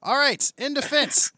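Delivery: big booming courtroom call; loud announcing. Acted. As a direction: announce it like opening a trial.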